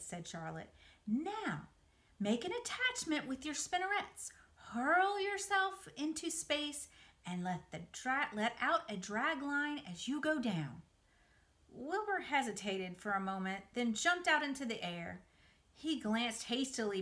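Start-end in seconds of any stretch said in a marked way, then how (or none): 1.1-10.8 coaching spider. Acted. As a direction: coach him patiently from above. Charlotte coaches jumping.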